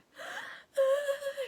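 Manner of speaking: high, loud sobbing wail, anime style; anime crying gasp. Retold or imitated. Retelling the girl on the stairs, loud anime-style crying gasp. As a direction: wail it like an anime heroine breaking down. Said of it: "you know that anime crying".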